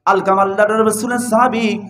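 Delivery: normal talking voice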